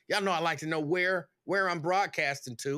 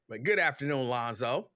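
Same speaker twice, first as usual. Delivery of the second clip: normal talking voice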